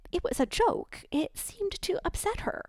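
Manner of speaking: embarrassed, trailing off; flustered lady. A narrator's reading. Confused lady explaining to Ellie, flustered and fading.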